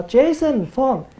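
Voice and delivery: high, curt call across the house; calling out to brother. Retold or imitated. Brother being called to the phone, curt high call.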